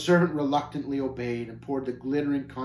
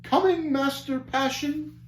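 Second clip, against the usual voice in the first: very high, thin servant voice, drawn out; nasal high servant; 12 semitones higher, slower than usual. Acted. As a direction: squeak it like a cowed little servant. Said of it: "a nasal, high-pitched voice".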